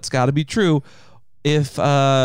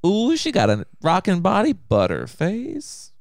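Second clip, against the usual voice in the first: acted example remark; 6 semitones higher, slower than usual. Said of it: "it'd be like".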